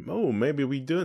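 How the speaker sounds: normal talking voice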